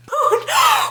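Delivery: sharp, high, loud gasp; shocked gasp. Real, not acted. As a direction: gasp at a sudden plot twist. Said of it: "the gasp I just gasped".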